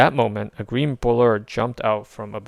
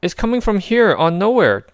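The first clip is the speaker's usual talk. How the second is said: higher, louder raccoon alert; 11 semitones higher, 7 dB louder than usual. A narrator's reading. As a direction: call it out urgently. Rocket voice, alarmed.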